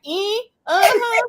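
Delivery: high, drawn-out fake agreement; pretend understanding. Everybody faking they understood him, mock agreeing. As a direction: nod along vocally without a clue. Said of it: "because everybody be like".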